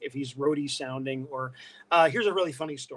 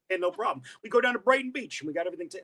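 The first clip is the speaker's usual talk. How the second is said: quick, higher Brooklyn chatter; Brooklyn accent; 4 semitones higher, faster than usual. Shown as a demonstration. Showing how he sounded as a kid, quick Brooklyn accent. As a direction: rattle it off in old Brooklyn. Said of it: "that's exactly how I would sound".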